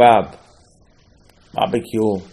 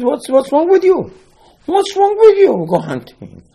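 very high, loud indignant cry; very high loud outrage at the hunter; outraged; 7 dB louder than usual. Acted. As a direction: shout your disbelief at him.

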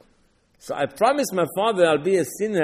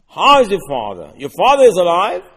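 louder, insistent retelling of Yosef's question; pressing question; 2 semitones higher, 9 dB louder than usual. Retold or imitated. Yosef presses his brothers about their father, loud.

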